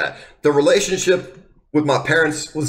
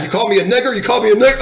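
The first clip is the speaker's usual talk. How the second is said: rising indignant yell, louder on the repeat; retold angry shout; 8 semitones higher, 4 dB louder, faster than usual. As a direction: throw the accusation back, building. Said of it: "he just still gets fucking mad".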